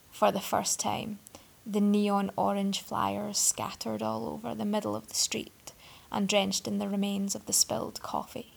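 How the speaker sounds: plain reading voice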